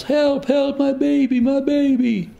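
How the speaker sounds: high, loud panicked pleading; a frantic mother screaming for her baby; desperate cry for help. Retold or imitated.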